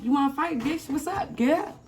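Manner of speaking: taunting challenge. Retold or imitated. Mother challenging daughter.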